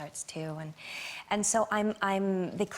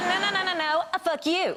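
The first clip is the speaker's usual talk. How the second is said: high, bantering refusal and comeback; playful protest; 7 semitones higher, much faster than usual. Retold or imitated. Guest trading insults with the film star, playfully insistent. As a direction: bat it back, pitched up and playful. Acted escalating insult exchange.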